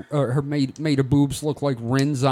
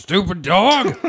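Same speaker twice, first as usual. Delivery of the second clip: Eustace voice; 4 semitones higher, 4 dB louder, slower than usual. Retold or imitated. Quoting Eustace from Courage.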